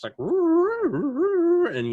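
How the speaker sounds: imitating a fifties space film effect; mock fifties sci-fi sound effect. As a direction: wail it out like an old space movie gadget. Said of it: "like, sound effects".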